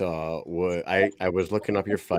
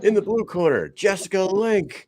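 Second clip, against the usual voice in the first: ring announcer; 10 semitones higher than usual. Retold or imitated. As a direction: stretch out the fighter's name. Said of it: "He was like".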